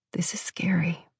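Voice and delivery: hushed and gentle; soft whisper. A narrator's reading. Firefighter soothing the trapped girl, gentle whisper. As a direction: whisper it to calm her.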